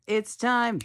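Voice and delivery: normal talking voice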